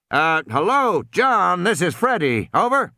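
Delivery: high crackly radio caller. Acted. Captain hailing over the radio. Freddy radio call repeated.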